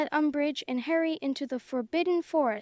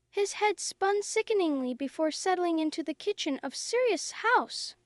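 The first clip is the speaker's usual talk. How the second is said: usual speaking voice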